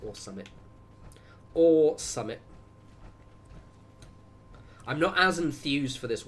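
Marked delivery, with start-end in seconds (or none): 0.1-0.5 soft, low throwaway English slang; low mumbled aside. Pretend. Streamer playing English football fan, low throwaway aside. 1.5-2.4 higher, louder, emphatic English slang; loud mock-laddish repeat. Streamer playing English football fan, loud and emphatic.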